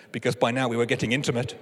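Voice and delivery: ordinary delivery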